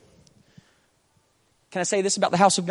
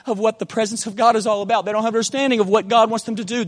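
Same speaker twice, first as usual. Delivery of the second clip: ordinary talk, nothing marked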